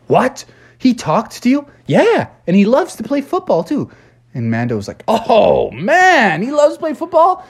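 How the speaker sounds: can't believe it, pitch climbing; amazed. Acted. Mando hearing Grogu plays football, rising amazement.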